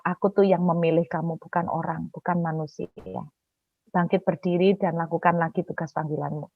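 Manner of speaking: hushed, low, solemn divine voice; voice of God. Retold or imitated. God calls the fallen preacher back to duty, hushed solemn voice. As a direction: speak very softly and low, as God calling. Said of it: "Tuhan itu berbicara".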